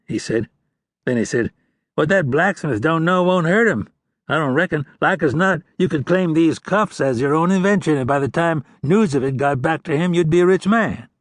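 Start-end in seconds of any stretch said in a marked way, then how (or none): 1.9-11.1 lightly voiced wheedling outlaw, a bit higher; sly outlaw. A narrator's reading. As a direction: coax the ranger like a slick crook. Outlaw Lang talks to ranger.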